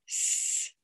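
a long unvoiced sss for S; hissed S sound. Shown as a demonstration. Teacher models the S sound to the child, hissed. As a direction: hold the S as a hiss. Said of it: "Do it with me".

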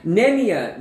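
ordinary talk, nothing marked

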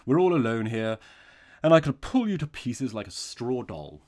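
calm demand building to a loud threat; unchanged voice, veiled threat. A narrator's reading. Robber demands the priest's sapphire cross, unchanged voice. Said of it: "without changing by the faintest shade his attitude or voice".

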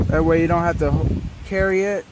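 usual speaking voice

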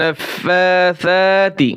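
নাফাসা very slow, with a long ফা stretched by one alif; recitation with madd. Shown as a demonstration. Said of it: "এক আলিফ টান হবে". (এক আলিফ টেনে তেলাওয়াত)

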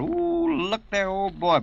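high excited mock holler; a skinny little husband hollers at a passing beauty. Man ogling bathing beauties, high excited hollering. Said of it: "he'd jump up and down and holler".

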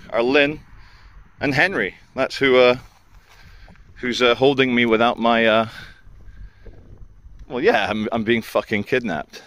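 ordinary talk, nothing marked

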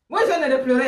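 ordinary talk, nothing marked

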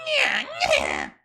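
very high, laughing shouted bird cry; hammed-up bird nya; Angry Birds squawk. Shown as a demonstration. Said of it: "my Angry Birds impression".

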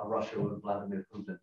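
normal talking voice

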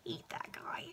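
real whispered aside, very soft; muttered dislike. Real, not acted. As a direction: drop to a whisper, as if grumbling to yourself. Reader's muttered aside about villain.

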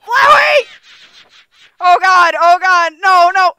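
loud laughing yell; panicked shout. Real, not acted. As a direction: scream his name in mock horror. Yelling at Flowey, laughing.

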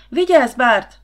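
loud, high cry of alarm; shouted warning. A narrator's reading. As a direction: scream a warning to someone in danger. Nóra screams a warning.

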